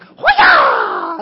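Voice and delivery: huge high war whoop; battle cry. Retold or imitated. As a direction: yell it like charging with a sword. Peter swinging his sword.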